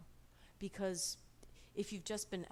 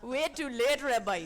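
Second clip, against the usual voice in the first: line laughed out much louder; laughing, louder retake; 4 semitones higher, 8 dB louder than usual. Acted.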